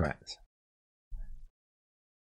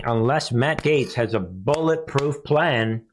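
ordinary talk, nothing marked

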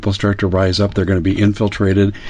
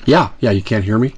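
garbled call audio; breaking up over the phone; call dropping out. Real, not acted. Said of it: "I just totally cut out here".

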